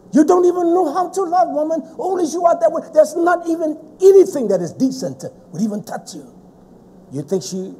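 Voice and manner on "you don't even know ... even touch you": high, loud scolding rant; retold tirade. Preacher re-enacts berating his sister, high and loud. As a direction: berate her without pause.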